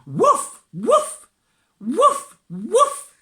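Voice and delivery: high, loud barking woofs; dog barking woofs. Acted. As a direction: bark like a happy dog. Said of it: "goes Rusty the dog".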